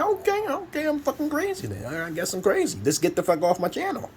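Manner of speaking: sarcastic high-pitched "okay, I'm crazy" brush-off; mocking retort. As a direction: toss it off high and sneering, fake agreeing. Mocks people calling him crazy.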